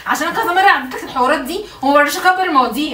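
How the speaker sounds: loud, high furious threat; angry threat. Real, not acted. Wife threatening to tell her father about him, furious shout. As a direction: warn him you'll tell your dad.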